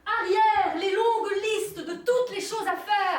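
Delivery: slow, loud, high proclamation; declaiming loudly. Acted. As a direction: shout it out to the sky. Friend joins the loud declaiming.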